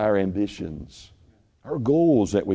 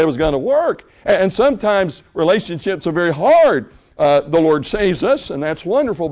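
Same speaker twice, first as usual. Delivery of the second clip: rising, louder pulpit preaching; 9 semitones higher, 6 dB louder than usual. Acted. Pastor preaching to his church, loud and rising. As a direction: drive it home to the congregation.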